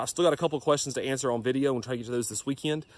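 normal talking voice

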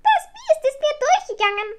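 high, loud little witch protesting; kleine Hexe rufend. A narrator's reading. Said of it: "rief die kleine Hexe". (hohe, laute Hexenstimme, empört gerufen)

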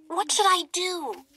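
high squeaky mouse voice; a little mouse pleading to be known; tiny mouse princess. Acted. Mouse Hildy asks.

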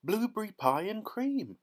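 high, ecstatic cry; thrilled, high-pitched exclamation; excited shout. A narrator's reading. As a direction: announce it in delight. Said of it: "shouted Violet".

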